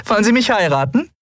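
put-on high, delighted young heroine's voice; beaming proposal. A narrator's reading. Endriel proposes to Yunnan, beaming and high-voiced. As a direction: pop the question brightly and high. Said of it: "strahlte ihn an".

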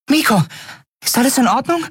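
high, small, concerned character voice; small creature's voice. A narrator's reading. Nelen checks on Miko after the attack, high-voiced and concerned. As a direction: ask anxiously in a tiny high voice.

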